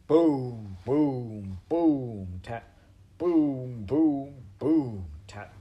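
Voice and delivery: loud falling booms, small dry tap; ominous drum imitation. A narrator's reading. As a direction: beat out a drum with your voice. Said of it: "went something like this".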